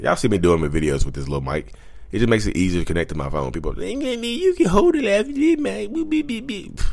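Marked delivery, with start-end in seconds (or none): 3.8-6.8 very high, slow silly voice then beeps; a very high, slow put-on voice ending in mock censor beeps. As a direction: squeak it out, then beep.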